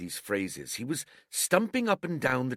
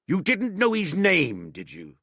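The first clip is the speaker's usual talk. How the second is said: high, slow, pressing question; lightly voiced pirate questioning a sailor; stern old sea cook; 8 semitones higher, 4 dB louder than usual. A narrator's reading. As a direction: corner him with the question. Silver questioning Morgan.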